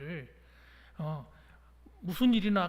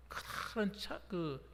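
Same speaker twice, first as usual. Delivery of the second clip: ordinary delivery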